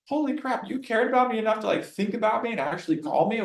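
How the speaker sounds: playing a client stunned that you called; thrilled client gasping at the care shown; amazed. Client amazed by follow-up call.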